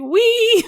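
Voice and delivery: put-on high squealed wee of a kid gliding on wheels; playful wee. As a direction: squeal it like a kid riding past. Mimicking Heely rider gliding.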